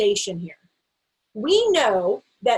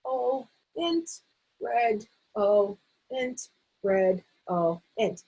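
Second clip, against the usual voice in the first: redolent broken into lone syllables, red, o, int; one syllable each; 4 dB quieter, much slower than usual. Shown as a demonstration. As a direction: call out each syllable with a gap. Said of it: "ask them to name a syllable".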